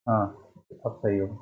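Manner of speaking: usual speaking voice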